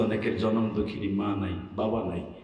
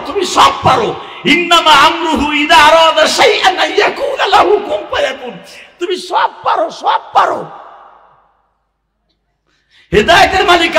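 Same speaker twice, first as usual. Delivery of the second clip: high, loud, desperate crying-out to God; impassioned imitation of wailing prayer; acted wailing plea; 15 dB louder than usual. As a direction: act out a frantic tearful begging, rising to a shout. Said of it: "এত জোরে জোরে কানছে, জীবনে কোনোদিন এত জোরে জোরে চিল্লাই চিল্লাই কান্দে নাই".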